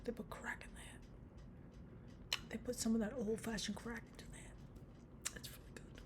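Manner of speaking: slow hushed whisper. Real, not acted. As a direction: lean in and whisper it like a secret. Secret aside about the song.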